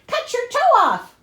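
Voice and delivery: high, loud cold command; harsh stepmother order. Acted. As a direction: bark it like a cruel mother. Mother's cruel order voiced.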